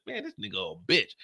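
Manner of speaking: low dismissive heckler; deep mocking chat voice. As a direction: drop low like a sneering heckler. Voicing the chat insulting him.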